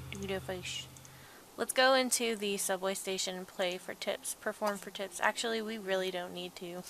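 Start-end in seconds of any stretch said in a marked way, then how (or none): 0.1-0.9 unvoiced little whisper. Real, not acted. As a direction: breathe it quietly. Whispered aside.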